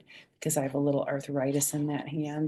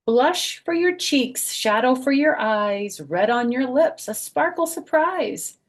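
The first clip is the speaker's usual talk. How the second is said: higher, louder, slow sing-song; reading to children; 6 semitones higher, 6 dB louder, slower than usual. A narrator's reading. Grandma reading makeup page to kids, slow sing-song. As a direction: savour each rhyme slowly. Reads book text.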